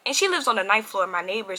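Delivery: usual speaking voice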